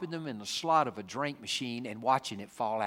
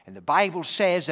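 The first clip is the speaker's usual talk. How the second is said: ordinary talk, nothing marked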